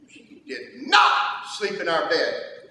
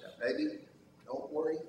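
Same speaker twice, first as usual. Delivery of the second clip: much softer, lower comforting hush; soft reassurance; 3 semitones lower, 12 dB quieter, faster than usual. Retold or imitated. Father soothing his daughter at bedtime, very softly. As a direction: soothe a scared little one.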